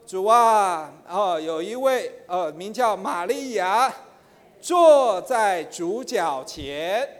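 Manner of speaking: drawn-out reading, each phrase weighed; a touch of solemn slow reading; slow emphatic reading. Shown as a demonstration. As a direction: read the verse word by word, slowly. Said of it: "比如说呢".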